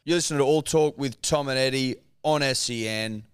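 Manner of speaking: measured presenter read, starting high and loud; radio host ident. Real, not acted. Host reintroducing the show and guest on air, presenter style. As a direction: announce the show and guest to listeners.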